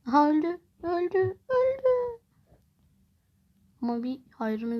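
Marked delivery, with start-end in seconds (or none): 0.1-2.2 climbing higher with each repeat; real excited shrieking; gleeful shriek. Real, not acted. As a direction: squeal of delight at the kill. Enemy dies, rising shriek.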